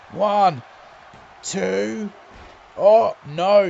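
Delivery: loud count, shouted oh no; excited pin count. Real, not acted. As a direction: count it, then shout in dismay.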